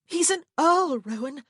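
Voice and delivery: high, loud alarm; urgent, high-pitched insistence; frantic. A narrator's reading. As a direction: exclaim it in panic. Frantic interruption continues.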